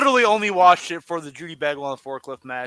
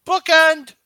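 louder, higher excited call; 11 semitones higher, 9 dB louder than usual. Real, not acted. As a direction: yell the move name. Calling the finisher again.

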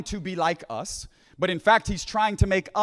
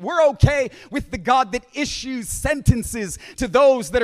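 building preaching; 2 semitones higher, 4 dB louder than usual. Acted. Preacher pressing the congregation about being okay with God's judgment, impassioned preaching. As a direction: drive each repeated phrase home.

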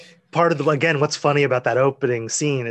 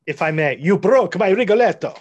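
put-on Italian accent; 6 semitones higher than usual. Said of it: "In a, in a funny Italian accent".

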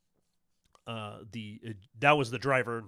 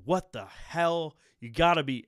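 a driver caught off guard, rising louder; startled driver; 5 semitones higher, 6 dB louder than usual. Acted. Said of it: "you hear a guy go".

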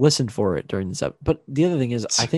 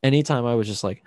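ordinary delivery

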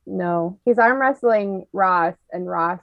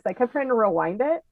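ordinary talk

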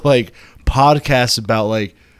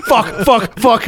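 defiant repeated swearing; 11 semitones higher, 3 dB louder, slower than usual. Shown as a demonstration. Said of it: "I'm gonna keep saying it".

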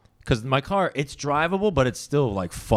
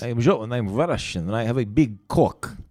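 comic thick Russian introduction; exaggerated Russian accent. Shown as a demonstration. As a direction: announce it as a mock Russian. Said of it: "it's like a Russian".

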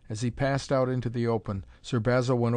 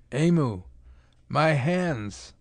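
louder, anguished cry of despair; roar of despair; 4 semitones higher, 4 dB louder, much slower than usual. Acted. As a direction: howl it out in despair at the ruined hands. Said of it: "wild roar of despair".

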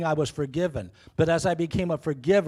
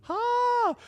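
high sustained ah; a hammed-up tongues-style cry; mock Hebrew. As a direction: sing out a high joking ah. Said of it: "let me say that in the Hebrew".

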